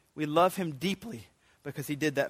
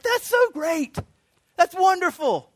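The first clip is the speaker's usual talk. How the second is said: high and louder, delighted; really mean the praise; excited praise; 12 semitones higher, 6 dB louder than usual. Retold or imitated. Father delighted by his child, excited praise. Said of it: "I'm so excited".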